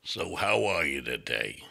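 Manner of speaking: slow, low abrasive clown voice; gruff drunk clown, drawn out; Murray the clown. Shown as a demonstration. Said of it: "This is Murray's voice right here".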